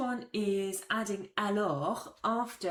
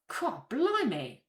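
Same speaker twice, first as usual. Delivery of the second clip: slow, drawn-out higher amazement; surprised; 8 semitones higher, 4 dB louder, much slower than usual. Shown as a demonstration. Teacher translating the shock into English, amazed. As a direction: stretch it out in disbelief. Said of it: "the tone ... does a lot of work".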